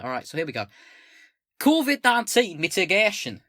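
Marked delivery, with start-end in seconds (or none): 1.6-3.4 loud, high put-on northern accent; unplaceable northern-ish accent. Streamer reading manifesto heading aloud, botched northern accent. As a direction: declaim slowly in a vague northern accent. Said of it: "What accent is this?".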